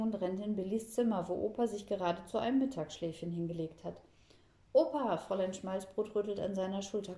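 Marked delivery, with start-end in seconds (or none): 4.7-5.2 high, loud call to rouse someone; urgent excited call. A narrator's reading. Girl rousing grandpa from his nap, excited call.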